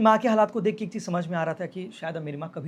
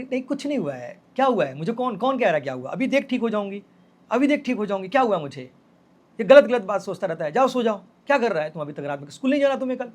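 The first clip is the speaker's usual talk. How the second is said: higher, louder imitation of a scolding mother; retold motherly scolding; 4 semitones higher, 6 dB louder than usual. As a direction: brush off the worry, then scold the child to bed. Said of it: "मुझे जैसे भी हो डांट वाट के".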